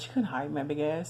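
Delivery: ordinary talk, nothing marked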